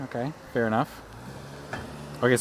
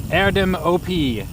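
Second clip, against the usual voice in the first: put-on higher, louder, drawn-out German accent; 6 semitones higher, 5 dB louder, slower than usual. Reading out a possible German's name, in a German accent. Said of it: "I said that with a German accent".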